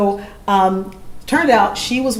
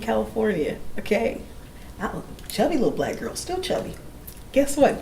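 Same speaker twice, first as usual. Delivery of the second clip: softer, gentle encouragement; tender reassurance; 4 semitones higher, 6 dB quieter than usual. Retold or imitated. The singer comforting a tearful student, soft and tender. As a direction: comfort a crying girl. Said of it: "I said".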